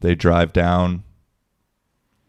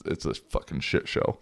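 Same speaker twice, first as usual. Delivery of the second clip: muttered aside; 3 semitones lower, 7 dB quieter than usual. Real, not acted. Grumbling about refs' whistles.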